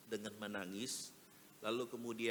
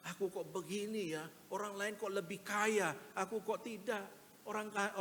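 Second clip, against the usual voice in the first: much higher, plaintive whine of comparison; self-pitying complaint; 9 semitones higher than usual. Retold or imitated. Preacher voices a believer who feels inferior, higher plaintive whine. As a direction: sound like someone feeling small and envious.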